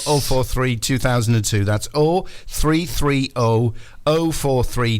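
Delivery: ordinary talk, nothing marked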